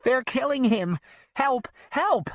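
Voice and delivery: high, loud cries for help; desperate old woman yelling help; panicked yell. A narrator's reading. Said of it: "his grandma yells".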